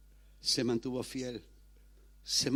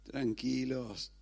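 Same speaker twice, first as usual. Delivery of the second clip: calming hush; much slower than usual. Acted. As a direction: hush them gently, taking your time. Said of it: "Ahí viene Jesús y dice".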